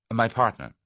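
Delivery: proud, firm little declaration; with dignity. A narrator's reading. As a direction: stand on your dignity. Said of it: "said Tuppence with dignity".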